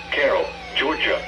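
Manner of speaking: normal talking voice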